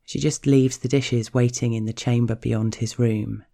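ordinary delivery

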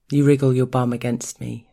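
ordinary delivery

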